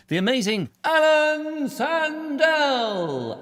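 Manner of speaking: bill him like a Hollywood star on stage; showbiz announcer. Pretend. Host introducing the amazing act, drawn-out showman call.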